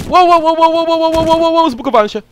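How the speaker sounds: delighted squeal at the game bug; high yelling wows. Real, not acted. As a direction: yelp out the wows in giddy disbelief. Glitched, excited yelling.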